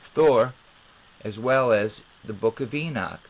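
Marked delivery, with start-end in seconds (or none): none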